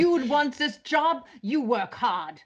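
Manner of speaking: slow, hammed-up German accent; bad German accent. Joking about German film bosses to the panel, bad German accent. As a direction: order them about in a badly faked German accent. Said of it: "bad German accent".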